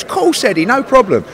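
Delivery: high-pitched imitation of an obliging promoter; quoted reply, pitched well up; easygoing promoter's reply. Quoting promoter's imagined easy reply.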